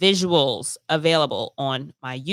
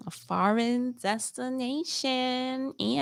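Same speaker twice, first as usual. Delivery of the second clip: high, hushed, drawn-out squeal of anticipation; excited tease; 7 semitones higher, 7 dB quieter, slower than usual. Real, not acted. Host teasing next week's trip to listeners, excited. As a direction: draw it out slowly, high and soft with excitement. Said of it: "I'm excited".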